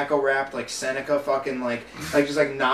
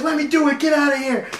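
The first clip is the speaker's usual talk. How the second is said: higher, irritated brush-off; impatient coworker; 9 semitones higher, 4 dB louder than usual. Retold or imitated. Coworker pushing the trainee away, higher irritated. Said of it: "she'd be like".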